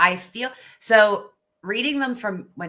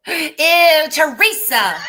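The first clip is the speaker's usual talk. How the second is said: loud, high grossed-out cry; disgusted; 9 semitones higher, 8 dB louder, much slower than usual. Pretend. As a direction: recoil in disgust. Mock trooper disgust after gasp.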